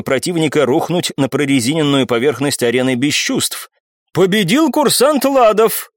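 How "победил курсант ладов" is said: high, slow, dumbfounded declaration; drawn-out, higher-pitched verdict from a taken-aback judge; stunned announcement. A narrator's reading. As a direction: announce the winner in disbelief. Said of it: "оторопевшим голосом произнесла женщина".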